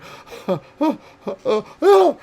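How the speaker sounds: high, loud horrified gasps; shocked horror. Acted. Narrator acting a character's shock and horror, horrified gasps. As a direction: gasp and cry out in horror. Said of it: "look of shock and horror".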